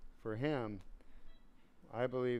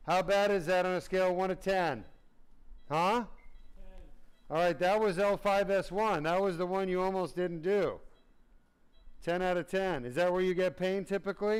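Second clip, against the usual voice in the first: higher and louder, clinical and urgent; loud questioning; 5 semitones higher, 5 dB louder than usual. Real, not acted. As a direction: call each question to the patient. Pain scoring with patient.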